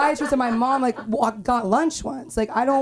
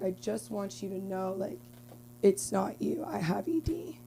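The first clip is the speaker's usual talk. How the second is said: much softer, ending in a whisper; hushed confession; 12 dB quieter than usual. Retold or imitated. Guy's embarrassed text, read as a soft whisper. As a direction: confess it under the breath. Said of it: "He's like".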